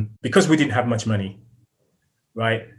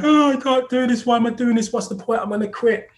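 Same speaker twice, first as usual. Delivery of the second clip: much higher, whining and tearful; imitated despair; 11 semitones higher than usual.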